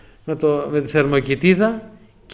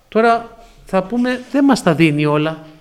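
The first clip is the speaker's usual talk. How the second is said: preaching; 5 dB louder than usual. Acted.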